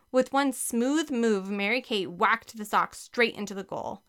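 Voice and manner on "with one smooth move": ordinary delivery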